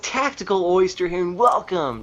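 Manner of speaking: high bright YouTuber intro voice; Tactical Oyster impression. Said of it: "'cause I sound like this".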